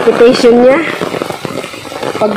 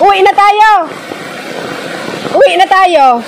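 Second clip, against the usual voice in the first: calling out; 9 semitones higher, 3 dB quieter than usual. Real, not acted. Calling to boy in rain.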